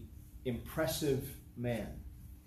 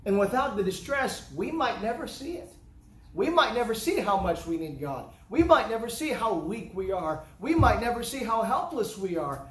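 rising, louder preaching with each repeat; building sermon; 7 semitones higher, 6 dB louder than usual. Acted. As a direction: drive the point home harder each line.

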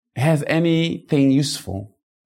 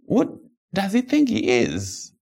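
mock indignant; 11 semitones higher than usual. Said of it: "people are like".

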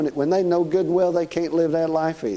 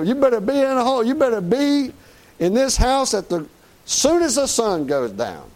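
a mom laying down the curfew; mama's warning; 7 semitones higher than usual. Retold or imitated. Preacher mimicking a mother warning her boy.

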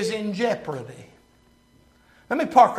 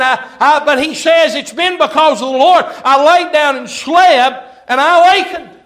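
high, loud, urgent sermon voice; full-force preaching; 7 semitones higher, 7 dB louder than usual. Real, not acted. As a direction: hammer it home to the congregation.

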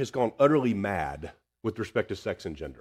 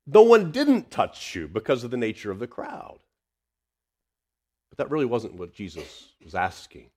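usual speaking voice

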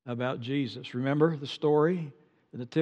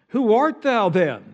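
pressing question; 5 semitones higher, 6 dB louder, slower than usual. Retold or imitated. Priests pressing John.

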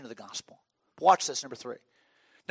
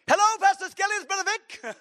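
very high, exuberant phone greeting; Brother Vic; 5 dB louder than usual. Retold or imitated. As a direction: burst onto the line in a piercing excited voice. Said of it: "he talked like that".

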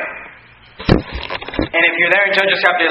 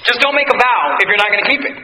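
usual speaking voice

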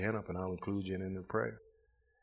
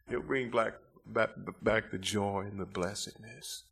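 ordinary talk

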